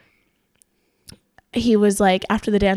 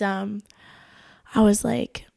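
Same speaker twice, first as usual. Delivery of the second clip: ordinary talk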